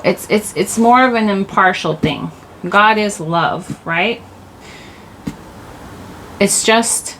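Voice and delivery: ordinary delivery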